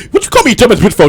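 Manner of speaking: high, loud offended question; mock indignant comeback. Acting out the reply to her insult, high indignation.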